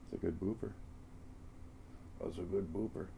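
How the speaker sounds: soft cooing praise; silly clown. Real, not acted. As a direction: coo gently at the pet. Praising the cat softly.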